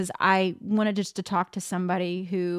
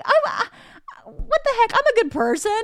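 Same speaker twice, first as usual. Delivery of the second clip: indignant disbelief; 4 dB louder than usual. Retold or imitated. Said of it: "man, I was like".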